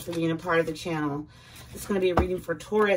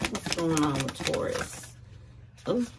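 normal talking voice

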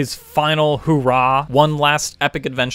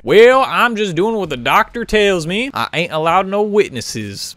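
hammed-up country accent henchman; 4 semitones higher, 5 dB louder than usual. Nazi answering why he shot a teacher, in a mock country accent. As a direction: put on a drawling country accent. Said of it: "there's one with a country accent".

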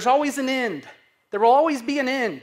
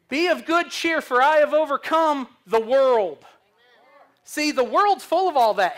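preaching at full force; 3 semitones higher, 5 dB louder than usual. Acted. Preacher declaring victory over the world to congregation, fervent.

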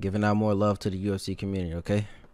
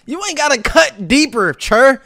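shouting high and loud; hype yell; 14 semitones higher, 10 dB louder than usual. Real, not acted. Streamer yelling at a chatter, loud hype shout.

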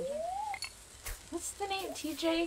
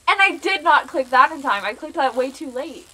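loud, higher incredulous outburst; loud disbelief; 4 semitones higher, 12 dB louder than usual. Real, not acted. Streamer protesting a mistimed click, in loud disbelief. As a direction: burst out loudly at the game's nonsense.

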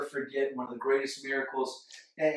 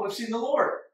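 excited; 9 semitones higher, 4 dB louder than usual. Retold or imitated. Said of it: "the other disciples told him".

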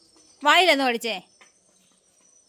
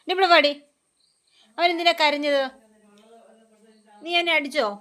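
raised, higher scolding; scolding the pet; 4 semitones higher than usual. Real, not acted. Scolding the pet who bit child.

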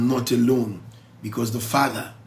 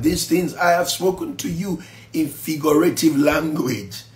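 ordinary delivery

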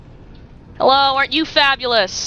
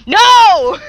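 loud, high drawn-out shout; explosive cry of protest; 8 semitones higher, 8 dB louder than usual. Real, not acted. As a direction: yell in sudden dismay. Plan fails suddenly.

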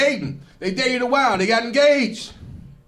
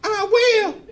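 ecstatic bride; 4 dB louder than usual. Retold or imitated. Bride's answer, acted.

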